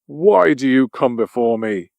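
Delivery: a five-eyed beast demanding an answer; deep roar. A narrator's reading. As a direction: beast challenging the Tin Woodman, lightly roared. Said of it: "Its deep voice roared".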